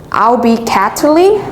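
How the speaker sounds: ordinary delivery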